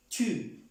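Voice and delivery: Tu said the French way. Shown as a demonstration. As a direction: round the lips on Tu. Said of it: "pronunciando la U".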